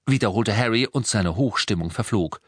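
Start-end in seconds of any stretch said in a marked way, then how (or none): none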